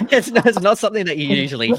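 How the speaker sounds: high, loud laughing words; giggling disbelief. Real, not acted. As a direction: laugh through the line, amused. Host laughing at opera surprise.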